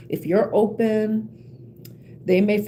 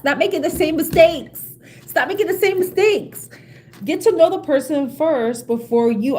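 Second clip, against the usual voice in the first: raised, high-pitched exhortation; emphatic scolding; 6 semitones higher, 4 dB louder than usual. Real, not acted. Urging listeners off repeated mistakes, emphatic scolding. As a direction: plead with them, high and insistent.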